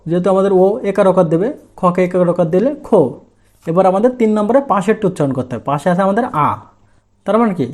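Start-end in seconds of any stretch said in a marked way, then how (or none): none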